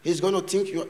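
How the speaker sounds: usual speaking voice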